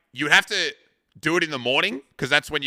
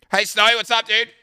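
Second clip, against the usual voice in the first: high, louder hello; cheery greeting; 9 semitones higher, 5 dB louder than usual. Real, not acted. Streamer greeting a viewer, high and upbeat. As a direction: call out to a viewer.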